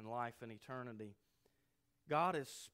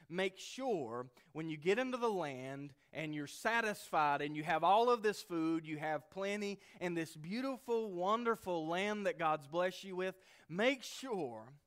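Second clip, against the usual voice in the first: louder and higher, pressing harder with each phrase; building urgent warning; 4 semitones higher, 6 dB louder than usual. Retold or imitated. Moses urging the people before the promised land, building loud and urgent. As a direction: swell up like a prophet pleading with a crowd.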